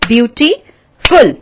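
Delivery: Beautiful slowly broken as beauty, pause, फुल; two-clap split. Shown as a demonstration. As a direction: sound out beautiful in two separated beats. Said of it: "फिर से करके देखो मेरे साथ". (Beautiful को दो तालियों में तोड़कर)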